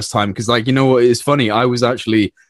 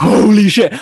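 high-pitched amazed exclamation; retold astonishment; 8 semitones higher, 4 dB louder, slower than usual. Telling friends about first seeing Starlink, re-enacted astonishment. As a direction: re-enact being stunned by the sight.

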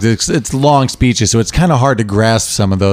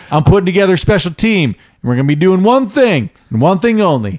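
Brad Pitt; 6 semitones higher than usual. Acted. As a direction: lay out the mission deliberately. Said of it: "talking about setting the team together".

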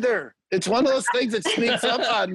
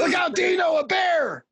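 mock scream; 10 semitones higher than usual. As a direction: shout a fake bear warning. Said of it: "not screaming and scary".

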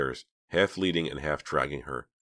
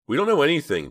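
high, loud, hasty frightened denial; girl quickly denies everything to the killer; 11 semitones higher, 8 dB louder than usual. A narrator's reading. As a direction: blurt the denial out fast. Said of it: "Dina said quickly".

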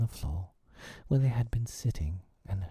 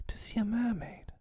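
put-on very high, longing girl's voice; crying out in delight; 4 dB quieter than usual. A narrator's reading. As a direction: marvel at it, pitched way up. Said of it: "Wendy cried".

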